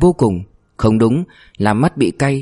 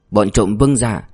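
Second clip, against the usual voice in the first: usual speaking voice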